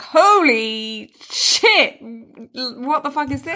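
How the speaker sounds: slow drawn-out "Holy shit"; mock astonishment. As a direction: stretch it out in amazement. Retelling first knee pit touch.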